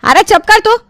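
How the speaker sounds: high, sharp telling-off; sharp scolding. Acted. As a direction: cut him short, annoyed. Mother snaps at her son. (बेटे को झिड़कना)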